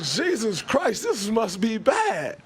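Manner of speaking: high, slowed mock dismay; dismayed realization. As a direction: draw it out, half-joking shock. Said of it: "I'm like".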